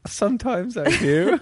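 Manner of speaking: high-pitched, laughing put-on voice; playful high voice breaking into laughter; laughing mock reply. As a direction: squeak it out through a laugh. Voicing the ruler's sheepish reply, laughing.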